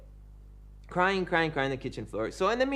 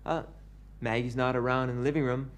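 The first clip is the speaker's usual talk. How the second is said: ordinary talk, nothing marked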